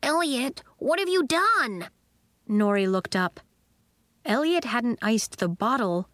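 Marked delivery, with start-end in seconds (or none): 0.0-1.9 high, aghast question; mildly horrified, pitch jumping up; gasped dismay. A narrator's reading. Sebastian to Elliot over the iced instruments, aghast. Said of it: "Sebastian gasped".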